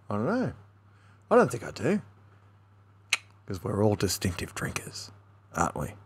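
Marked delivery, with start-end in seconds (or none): 3.5-5.9 hushed conspiratorial whisper. Real, not acted. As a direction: lean in and whisper it like a secret. Conspiratorial aside to viewers.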